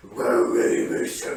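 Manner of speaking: very deep, gravelly dragon voice; Saphira. Retold or imitated. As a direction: growl it far below your own voice, exaggerated imitation. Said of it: "deep and gravelly".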